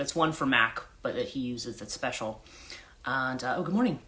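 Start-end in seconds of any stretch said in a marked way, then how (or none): none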